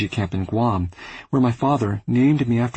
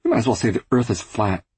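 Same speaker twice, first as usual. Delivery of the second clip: plain reading voice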